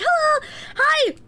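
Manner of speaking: high, slow, sugary hello; bubbly friend. Retold or imitated. Imitating a bubbly classmate greeting her in class, high and slow. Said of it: "she's a very positive, like, bubbly person".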